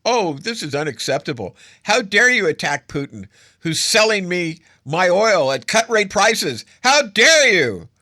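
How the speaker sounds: hammed-up huffy protest building to a high, loud How dare you; mock outrage, Modi. Mocking Modi's government defending Putin, building mock outrage. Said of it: "And Modi has said".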